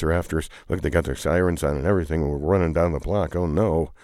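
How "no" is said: ordinary delivery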